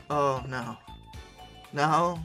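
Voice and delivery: normal talking voice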